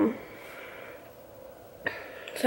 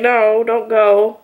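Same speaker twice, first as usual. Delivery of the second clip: slow, drawn-out plea; pleading; slower than usual. Retold or imitated. To Nick as he stands to leave, a slow begging plea. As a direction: beg him to stay, stretching it out.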